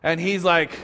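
usual speaking voice

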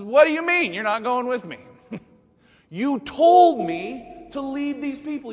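high, loud, exasperated outburst; indignant Moses demanding answers; Moses snaps. Acted. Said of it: "This is where Moses kinda snaps".

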